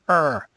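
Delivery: loud, high pirate "Arr"; pirate growl. Real, not acted. Frustrated outburst at game.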